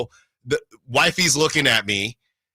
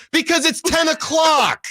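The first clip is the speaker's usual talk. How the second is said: exasperated retort; 11 semitones higher than usual. Retold or imitated. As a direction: throw it back at them, fed up with the stupid answer.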